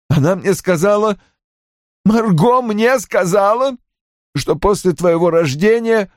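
high-pitched, anguished sobbing voice; tearful insistence. A narrator's reading. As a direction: insist desperately through swallowed tears. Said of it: "глотая слёзы и всхлипывая". (отчаянно настаивать высоким плачущим голосом)

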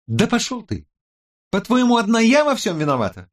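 very high, indignant woman's voice; angry retort. A narrator's reading. Tech expert refuses the blame, high and angry. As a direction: lash out, stung by the blame. Woman fights back in quarrel.

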